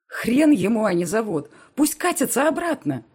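loud furious shout, pitch climbing high; angry shout. Acted. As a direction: yell it out in rage. Furious outburst at Mark's demand.

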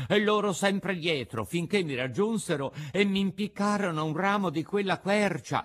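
high, loud puppet outburst; alarmed puppet. A narrator's reading. Puppet tells companions of assassins, high alarm. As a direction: announce it loudly as the puppet.

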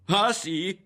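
high, loud mocking question; jeering. A narrator's reading. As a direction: scoff in disbelief. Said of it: "rise Berto".

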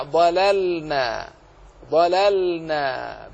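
slow, drawn-out "ضللنا" with a distinct L before N; clear still lam. Shown as a demonstration. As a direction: hold the vowelless L in "ضللنا". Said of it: "بين اللام". (إظهار اللام الساكنة في "ضللنا" بتمهل)

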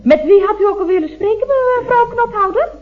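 fast and high, rushing past the blunder; hurried cover-up. Acted. Operator rushing to cover her gaffe with the caller. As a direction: gabble to change the subject. Operator hastily changes subject.

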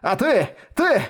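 high, manic raving boast; mad scientist. Acted. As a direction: rave like a madman, drawing out the end. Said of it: "безумно расхохотался".

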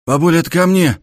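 calling out loud to another room; shouting. A narrator's reading. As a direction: shout it through the flat. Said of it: "прокричал внутри квартиры парень".

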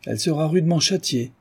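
high-pitched, impassioned comeback; heated retort. A narrator's reading. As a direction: fire back with warmth and conviction. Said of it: "répliqua la comtesse avec chaleur". (réplique vive, avec chaleur)

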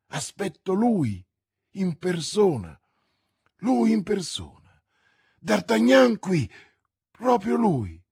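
loud, high, emphatic announcement; joyful triumph. Acted. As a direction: announce him proudly, punching each phrase. Bonacieux joyfully announces her lover's arrival.

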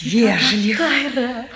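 slow amused whisper; whispered, amused remark about the polite stranger. Acted. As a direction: breathe it out with a laugh. Mocking remark after guest leaves.

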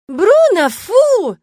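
loud, high indignant cry at the dog; indignant shout. A narrator's reading. Witch shouts at her dog Bruno, indignant. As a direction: yell at the thieving dog. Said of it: "крикнула я возмущённо".

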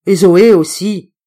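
loud, slow grumbling; a cranky uncle moving on to the next target; grumpy. Acted. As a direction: grumble it out, cross. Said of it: "terriblement bougon".

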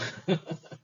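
amused run of chuckles; laughing. Real, not acted. Teacher laughing with students over a joke, amused chuckles. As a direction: chuckle along with the class.

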